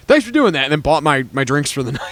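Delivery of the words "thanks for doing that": mock grateful guy. Bar owner thanking, retold.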